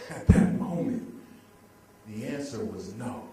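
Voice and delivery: ordinary talk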